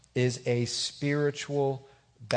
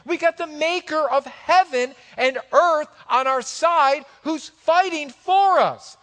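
high, loud, impassioned preaching; full-force preaching; 11 semitones higher, 8 dB louder than usual. Real, not acted. Preacher telling the church God fights for them, full-force preaching. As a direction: preach it out to the congregation, stretching key words.

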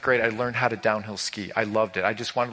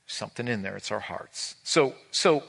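ordinary delivery

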